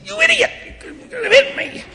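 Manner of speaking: very high laughing mock outburst; mock angry yell. Bishop mimicking himself yelling at a car, laughing. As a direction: play the startled pedestrian for laughs. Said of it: "I'd say".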